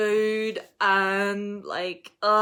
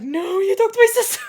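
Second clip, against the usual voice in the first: high, loud mock panic shout; hammed-up alarmed outburst; imitated freak-out; 13 semitones higher, 12 dB louder than usual. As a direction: shriek it like a panicked friend. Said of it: "She was like".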